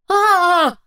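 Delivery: the neighbour yells in terror; yelling. Acted. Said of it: "орёт мой сосед".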